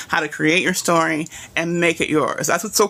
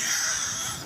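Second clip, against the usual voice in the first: high squeal of laughter; 10 dB quieter than usual. Real, not acted. Laughing at chat message.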